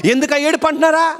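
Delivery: high-pitched imitation of family; mock household reproach; nagging relatives. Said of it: "నిన్ను చూసి ఇంట్లో".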